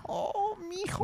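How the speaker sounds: high, soft wailing La Llorona; weeping woman. Retold or imitated. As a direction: wail like a weeping ghost mother. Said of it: "It's La Llorona".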